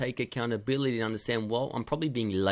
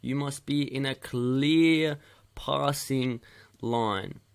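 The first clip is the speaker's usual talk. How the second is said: slow emphatic instruction; much slower than usual. Shown as a demonstration. Coach hammering the key point.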